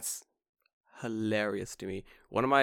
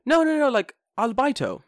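very high, loud insistence; insisting teacher impression; 13 semitones higher, 7 dB louder than usual. Teacher insists albaito is English, high loud imitation. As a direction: correct him in a squeaky put-on voice.

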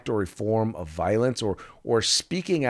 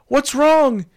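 imitating onlookers; 4 dB louder than usual. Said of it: "they say".

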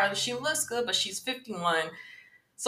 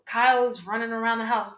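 ordinary talk, nothing marked

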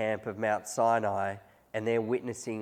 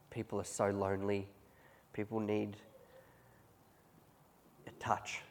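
softer, fading to a whispered "a touch"; softening; 2 semitones lower, 8 dB quieter than usual. Real, not acted. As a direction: let the voice drop away tenderly. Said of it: "tender plea about loneliness".

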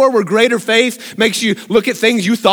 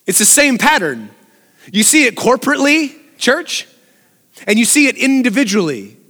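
ordinary delivery

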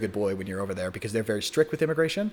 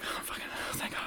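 breathless whisper of relief; relieved whisper; 12 dB quieter than usual. Retold or imitated. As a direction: whisper it like you just escaped. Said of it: "And I was like".

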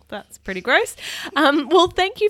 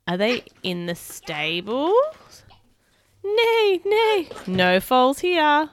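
animated picture-book reading; reading to children; 3 semitones higher, 5 dB louder, slower than usual. Acted. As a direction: read it like bedtime for little ones. Reads picture book aloud.

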